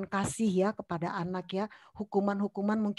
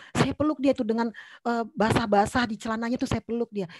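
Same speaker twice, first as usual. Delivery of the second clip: ordinary talk, nothing marked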